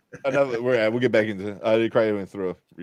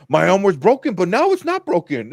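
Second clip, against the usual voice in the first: bad Boston accent, pitched up and loud; 8 semitones higher, 8 dB louder than usual. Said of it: "kinda bad Boston accent".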